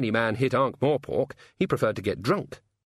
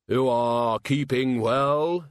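Death voice; 3 semitones higher, 3 dB louder, much slower than usual. A narrator's reading. Death speaking.

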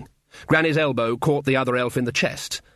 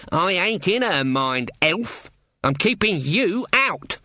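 slow, raised-pitch defiance; strained, higher and deliberate; defiant old witch; 6 semitones higher, slower than usual. Acted. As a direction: stand your ground against the intruder, word by word. Granny defies the Queen mentally.

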